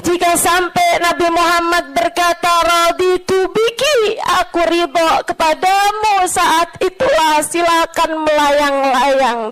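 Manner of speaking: high, stretched sermon climax; impassioned preaching. Real, not acted. Preacher promising the Prophet's approval, impassioned climax. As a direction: let the phrases soar and linger.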